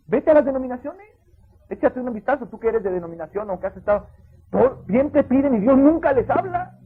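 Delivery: loud, high, slowing preacher's rebuke; preaching at full force. Acted. Pastor rebuking the congregation, full force preaching. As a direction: hammer it home from the pulpit.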